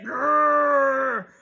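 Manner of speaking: growling grrr from the turtle; mock turtle growl. Snapper turtle rearing up at possum, growling.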